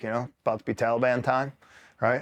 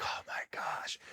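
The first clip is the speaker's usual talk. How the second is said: whispered, unvoiced aside; under the breath, very soft; whispered dismay; 14 dB quieter, slower than usual. Muttered dismay at his accident.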